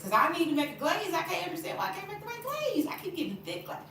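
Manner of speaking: high-pitched exasperated rant; exasperated venting. Real, not acted. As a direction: voice climbing high in frustration. Venting about failed glazes.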